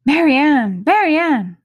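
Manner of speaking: loud, high calls for a servant; calling out. Acted. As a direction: call up the stairs for Mary Ann. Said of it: "said the voice".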